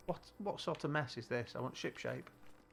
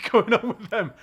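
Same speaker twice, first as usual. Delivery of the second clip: laughing; 10 semitones higher, 20 dB louder than usual. Real, not acted. Laughs while describing truck.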